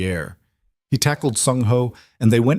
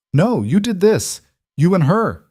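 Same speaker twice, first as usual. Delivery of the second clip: high, raised accusing retort; bitter accusation; 10 semitones higher, 4 dB louder than usual. Acted. Sung Ho blaming Kang Jun and Elena, raised and bitter. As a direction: throw the blame back at him.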